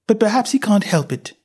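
put-on a well-mannered girl asking; young Alice. A narrator's reading. Alice thinks aloud.

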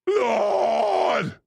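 big drawn-out belt on LORD; belted holler. Shown as a demonstration. Showing co-host how to belt it, loud holler. Demonstrates a deep belted shout.

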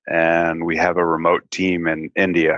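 normal talking voice

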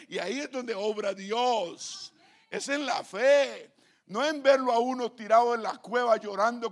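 slow, swelling pulpit cry, peaking loud and high; preaching at full force. Acted. Pastor to the church about faith, rising to a shout. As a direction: build each phrase until the last one roars.

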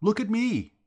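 very high, loud challenge; angry yell. A narrator's reading. Vowles confronting the staring George, high yell. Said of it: "he yelled".

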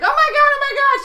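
very high, loud acted panic; panicking kid. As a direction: shriek it like a frantic boy. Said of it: "Third kid comes in".

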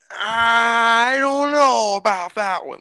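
high, slow, loud put-on voice; character impression. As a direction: drag it out in a silly high voice. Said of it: "Just like".

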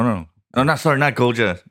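ordinary talk, nothing marked

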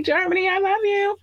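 high excited shoutout to Germany; squealy shoutout. Real, not acted. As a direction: squeal it out to the fans.

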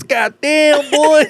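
very high-pitched mock astonishment; hammed-up squeal of disbelief; imitated shocked exclamation. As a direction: cry out high, imitating a stunned friend. Friend's shocked reaction acted out.

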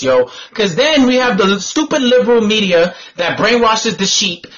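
usual speaking voice

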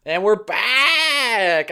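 very slow, drawn-out croaky announcer voice; dying turtle voice. Acted. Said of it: "you sound like a dying turtle".

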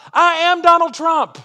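high, loud, slow, stress on Donald; ringing self-accusation; emphatic declaration. Real, not acted. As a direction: proclaim it like a confession from the pulpit. Climactic self-accusing sermon line.

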